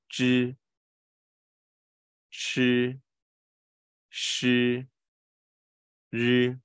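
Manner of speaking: slow teacher's model of curled-tongue consonants; retroflex drill. Shown as a demonstration. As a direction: hold 吱, 吃, 诗, 日 long and level. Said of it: "可是旁边是一直翘着的".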